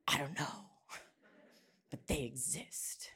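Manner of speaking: whispered, creeping dread; hushed whisper. Retold or imitated. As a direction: breathe it like a scared secret. Whispered fear at night to husband.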